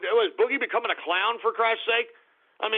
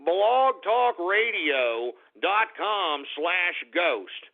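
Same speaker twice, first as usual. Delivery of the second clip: slow, spelled-out address; much slower than usual. Real, not acted. Spelling out the website.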